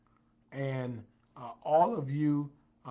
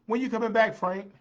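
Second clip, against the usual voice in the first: higher, louder call to a friend; 8 semitones higher, 8 dB louder than usual. Real, not acted. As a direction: call out the question, pitched up. Calling to absent viewer Frank.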